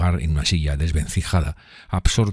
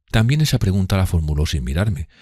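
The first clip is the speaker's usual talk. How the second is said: ordinary delivery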